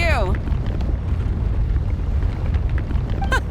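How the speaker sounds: manic evil cackle. Acted. Villain's spiteful triumph.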